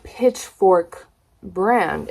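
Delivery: Pitchfork brand drawn out slowly; slow, curious Pitchfork brand; slow drawl. Real, not acted. Repeats tag slowly.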